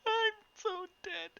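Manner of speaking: high, hammy fake sobbing; mock crying. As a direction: wail it like a mock tragedy. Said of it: "You okay?".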